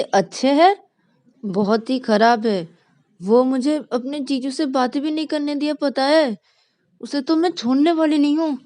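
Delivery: loud, high petulant rage; angry girl. Acted. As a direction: fume like a wronged younger sister. Rani fuming about Vikas.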